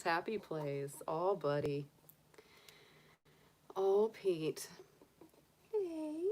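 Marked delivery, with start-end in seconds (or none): none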